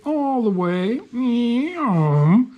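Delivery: high playful meow; playful sing-song. Real, not acted. Playful sing-song cat cry.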